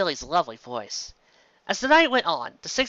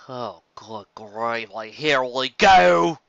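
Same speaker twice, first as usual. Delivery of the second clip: weary groan; 4 semitones lower, 3 dB louder, much slower than usual. Acted. Groaning at Pinkie's song again.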